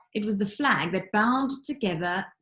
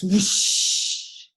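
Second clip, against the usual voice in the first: breathy whoosh of wind; 5 dB louder than usual. A narrator's reading. Narrator voicing the wind through the fireplace, airy whoosh. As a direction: blow out a long airy whoosh like wind in a chimney.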